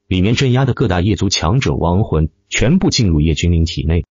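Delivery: usual speaking voice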